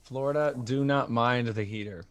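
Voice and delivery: ordinary talk, nothing marked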